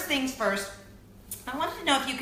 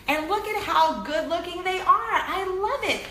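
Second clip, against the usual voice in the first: gushing; 8 semitones higher, 4 dB louder than usual. Real, not acted. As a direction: swoon over the dolls. Gushing over new dolls.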